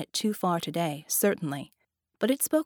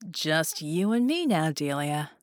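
genie; 4 semitones lower than usual. Acted. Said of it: "the genie said".

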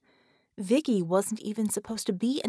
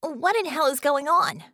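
high, loud, exasperated question; agitated raised voice; snapping demand; 8 semitones higher, 5 dB louder than usual. A narrator's reading. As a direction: demand an answer sharply. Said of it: "Bella snapped".

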